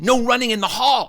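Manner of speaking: high, prim rule-quoting schoolgirl; imitated classmate telling him off; bossy girl. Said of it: "She was so bossy".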